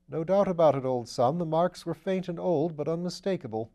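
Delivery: lightly voiced upper-class gent; airy, amused aristocrat; breezy gentleman voice. A narrator's reading. Wimsey's voice in the reading.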